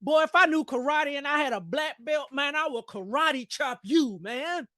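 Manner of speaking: lightly voiced mock anger; mock mad threat. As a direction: playfully threaten like you're mad. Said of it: "I'm like".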